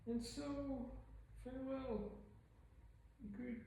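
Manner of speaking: high, soft, wise little alien voice; Yoda voice. Pretend. Vlogger doing a playful Yoda voice. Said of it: "doing that voiceover".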